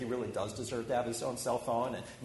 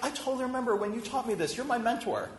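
pleading insistence; 8 semitones higher than usual. Retold or imitated. Retelling argument with mentor.

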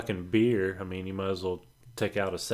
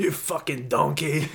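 Gordon Ramsay; 4 semitones higher than usual. Retold or imitated. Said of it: "You get fucking Gordon Ramsay".

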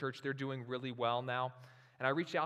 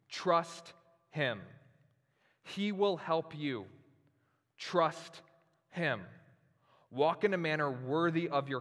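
slow, swelling, louder reading of a treasured letter; impassioned letter reading; 3 semitones higher, 4 dB louder, slower than usual. Acted. Pastor reading his mentor's letter to the church, impassioned and slow. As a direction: read it like a charge laid on you, building.

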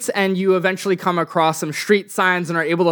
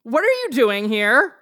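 higher-pitched indignant homeowner; higher voice of an affronted woman; 7 semitones higher than usual. Acted. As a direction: confront trespassers in a raised pitch. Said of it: "this woman comes out".

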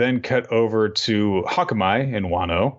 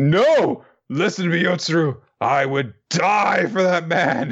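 tearful protest; 10 semitones higher than usual. Retold or imitated. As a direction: yell it through mock tears. Crying Kinemon denies crying.

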